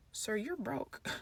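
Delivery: soft private aside; inner thought. Retold or imitated. Narrator thinking about her broke brother, softly. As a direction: mutter it to yourself. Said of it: "I'm thinking".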